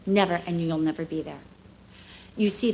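usual speaking voice

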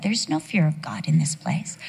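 whispered aside, unvoiced and soft; hushed whisper. Preacher voicing Abraham, whispered aside. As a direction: confide it under your breath.

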